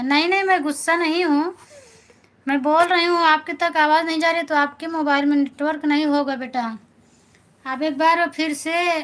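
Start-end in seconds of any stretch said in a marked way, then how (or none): 0.0-4.2 raised voice. Real, not acted. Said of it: "aunty आप गुस्सा हो क्या?".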